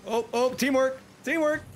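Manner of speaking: high excited cheer; real excited high-pitched teamwork cheer. Real, not acted. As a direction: squeal it out with glee. Excited teammate play.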